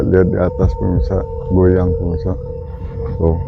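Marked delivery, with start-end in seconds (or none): none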